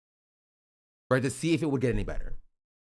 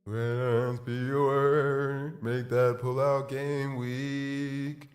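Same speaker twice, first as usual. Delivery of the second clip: slow warped autotune chant; broken auto-tune; much slower than usual. Real, not acted. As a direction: drag the words through glitchy autotune. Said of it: "I broke the auto-tune".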